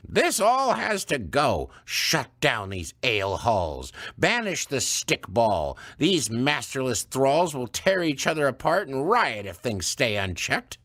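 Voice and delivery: stern elder woman; stern old woman. Acted. Missus Gudrun's voice.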